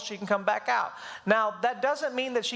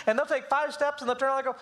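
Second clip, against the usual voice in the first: ordinary talk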